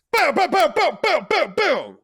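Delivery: loud high gunshot sounds; imitated gunshots. Imitating the gunmen firing at the Don. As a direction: mimic the shots.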